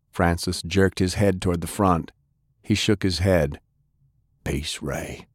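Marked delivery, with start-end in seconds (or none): 4.4-5.3 low and soft grim warning; a hurt man's low murmur; Sullivan's grim low warning; wounded heavy. Acted. Sullivan warns groups.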